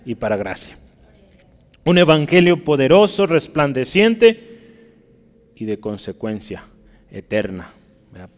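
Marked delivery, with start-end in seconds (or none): 1.8-4.5 loud, raised proclamation, stretching the last word; ringing preacher's declaration, slowing at the end; emphatic preaching. Real, not acted. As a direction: exalt it from the pulpit. Preacher exalts the gospel.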